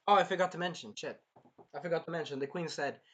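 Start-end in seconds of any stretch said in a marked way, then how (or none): none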